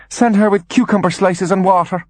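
high, demanding mother voice; lofty high-pitched instruction; imperious order. Acted. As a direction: issue the errand in a high register. Mother orders son about.

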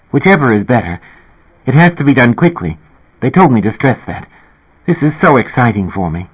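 Linda Fox. Acted. Narrator voices Linda Fox.

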